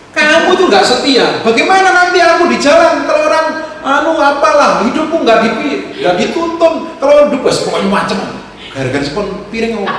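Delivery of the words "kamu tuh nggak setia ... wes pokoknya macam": wife's angry scolding. Retold or imitated. Preacher imitating his wife scolding him, angry and loud. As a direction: scold him like a hurt new wife over a sponge. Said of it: "Lah kok dia tuh ngomong begitu?".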